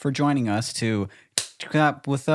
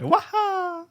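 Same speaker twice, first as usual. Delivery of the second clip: high cartoon plumber yelp; Mario impression. Showing off his Mario again, high yelp. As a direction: squeak it out like Mario jumping. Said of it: "do a good Mario impression".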